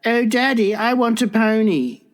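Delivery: put-on high-pitched child pleading with Daddy; mock English accent, little-girl voice. Acted English girl begging her daddy.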